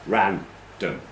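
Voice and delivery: "random" slowly split, strong RAN then weak dum; stress on first syllable. Shown as a demonstration. Teacher confirming the answer to learners, "random" slow with first-syllable stress. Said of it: "so like apple".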